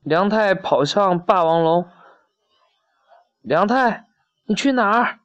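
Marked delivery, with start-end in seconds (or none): 3.4-5.2 high-pitched call without extra volume; lightly acted worried mother's call. A narrator's reading. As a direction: call after the child in a raised pitch. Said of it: "妈妈叫着".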